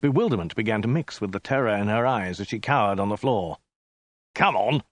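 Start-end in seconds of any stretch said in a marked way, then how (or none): 4.3-4.8 impatient soldier, mildly snappish; curt, irritated prompting; snapped order. A narrator's reading. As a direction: snap it impatiently. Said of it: "snapped the soldier".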